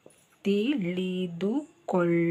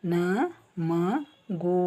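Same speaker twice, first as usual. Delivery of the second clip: slow demo; much slower than usual. Shown as a demonstration. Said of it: "this O".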